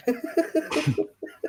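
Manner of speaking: high giggle; high laugh. Real, not acted. Laughing at the joke.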